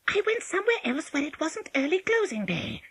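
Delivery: high, put-on wolf in disguise; false voice. A narrator's reading. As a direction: make up an excuse in a squeaky false voice. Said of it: "still in his false voice".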